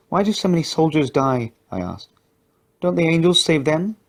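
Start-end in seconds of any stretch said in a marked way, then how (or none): 0.1-1.5 lightly voiced little girl, curious; a child's innocent question, a touch higher; young girl asking. A narrator's reading. Little Katie asks her companion, lightly higher child voice. 2.8-4.0 lightly voiced little girl, curious; a child's innocent question, a touch higher; young girl asking. A narrator's reading. Little Katie asks her companion, lightly higher child voice.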